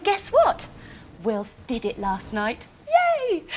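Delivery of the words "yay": high, excited cheer. Pretend. Teacher cheers Wilf for staying in bed, excited. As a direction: cheer like the whole class is clapping. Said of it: "give him a big round of applause".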